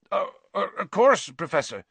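high, faltering happy stammer; stammer, overcome with relief; weak with happiness. Acted. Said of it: "sounded weak with happiness".

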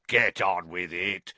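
gruff Auror sneering impatiently; sneered. A narrator's reading. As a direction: cut him off with contempt. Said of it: "sneered Moody".

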